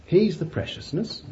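He's the preciousness, softly with He's stressed. Acted. Preacher echoes it softly to the congregation. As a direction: let it fall hushed.

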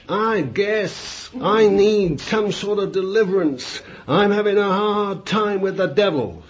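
put-on high, drawn-out American accent; attempted American accent. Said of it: "I can't reproduce the lovely accent".

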